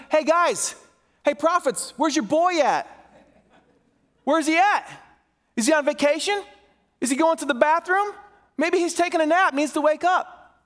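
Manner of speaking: high-pitched goading heckle; mocking taunt. Elijah taunts Baal's prophets about their missing god, mocking. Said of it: "he starts mocking them".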